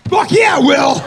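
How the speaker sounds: sudden high, full-throated yell; exuberant shout. Retold or imitated. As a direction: shout it in over-the-top triumph. Comic reply to protective gift.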